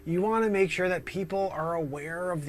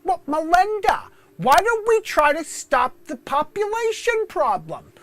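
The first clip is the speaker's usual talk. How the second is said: high, loud mock Bill Gates; hammed-up Gates calling out to his wife; loud mocking tech billionaire voice; Bill Gates impression; 12 semitones higher, 10 dB louder than usual. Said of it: "I'm doing the Bill Gates impression".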